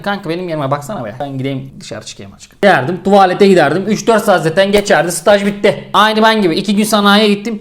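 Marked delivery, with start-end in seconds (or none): 0.0-2.6 hushed, sneaky plea to a friend; mock-secretive murmur; sneaky low ask. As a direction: quietly coax your buddy to cover for you. Sneaking off from internship duty.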